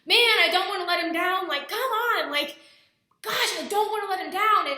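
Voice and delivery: half-crying, pleading with her dad. Retold or imitated. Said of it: "looked at my dad just crying".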